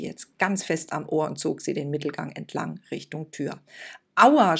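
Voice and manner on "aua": loud, high ouch; child yelps as priest pulls her ear; pained cry. Acted. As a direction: cry out in pain. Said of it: "schrie Lucia".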